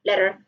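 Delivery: "letter" with a quick tapped t, like "ledder"; American r-coloured "letter"; American flap. Shown as a demonstration. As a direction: tap the tongue briefly in "letter". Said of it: "American pronunciation of".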